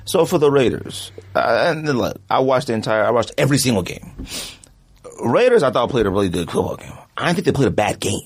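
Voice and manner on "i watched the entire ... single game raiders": normal talking voice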